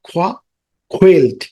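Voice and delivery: usual speaking voice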